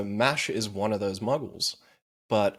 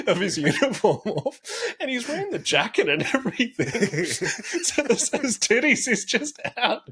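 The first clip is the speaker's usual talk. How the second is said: laughing; 12 semitones higher, 3 dB louder than usual. Real, not acted. Cracks up retelling anime scene.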